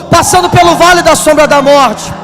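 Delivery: loud, high-pitched pulpit cry; impassioned shouted sermon; full-force preaching. Acted. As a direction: proclaim it fervently to a whole congregation.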